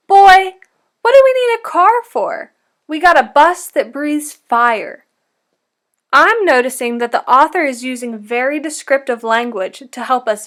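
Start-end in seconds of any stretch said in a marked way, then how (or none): 0.1-5.0 high, loud, cheerful grandmother; Nana's voice. Retold or imitated. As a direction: call out brightly like a jolly grandma. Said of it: "when she tells him".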